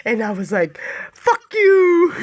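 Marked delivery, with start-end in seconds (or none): 1.1-2.1 loud, high retold shout; mock yell at the driver, louder and higher; yelled insult. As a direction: fling it at him. Yelling at guy in car.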